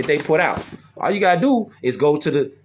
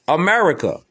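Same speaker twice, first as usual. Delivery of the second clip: ordinary talk, nothing marked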